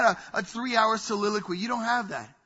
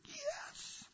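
squeaky yes; 15 dB quieter than usual. Retold or imitated. Abraham's answer, high squeak.

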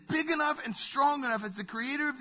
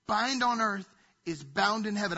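ordinary delivery

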